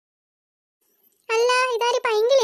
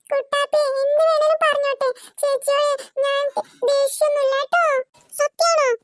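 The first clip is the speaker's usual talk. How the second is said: ordinary talk, nothing marked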